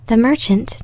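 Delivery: ordinary delivery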